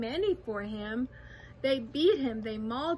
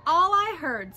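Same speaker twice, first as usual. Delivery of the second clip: loud, higher, curt retort; snapping; 4 semitones higher, 9 dB louder than usual. A narrator's reading. Kangaroo dismissing the tiny voices, snapping. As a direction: cut the claim down sharply. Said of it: "snapped the big kangaroo".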